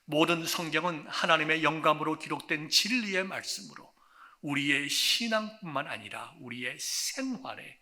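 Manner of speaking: normal talking voice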